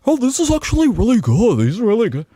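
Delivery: high, gushing mock fan. Host mimics viewers at home gushing, high mocking fan voice. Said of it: "you guys are sitting at home like".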